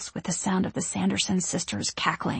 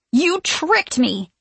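slow, higher, loud forced bravado; confident and dangerous; 8 semitones higher, 4 dB louder, much slower than usual. A narrator's reading. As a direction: try to sound dangerous. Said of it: "sound confident and a bit dangerous".

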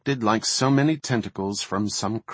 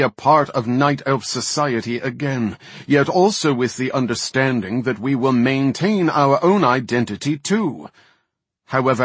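louder, higher, indignant plea; heated protest; 5 semitones higher, 6 dB louder than usual. Acted. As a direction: push back with indignation. Varden protests.

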